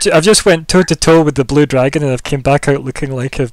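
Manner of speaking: mock exasperation. Drained hero asks the queen for advice, mock exasperated. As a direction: play up a loud, exasperated plea. Player acts out character's blunt plea.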